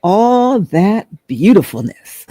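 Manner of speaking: slow, drawn-out stranger's compliment; lightly voiced man at the gas station admiring her. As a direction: savour each word like a charmed man.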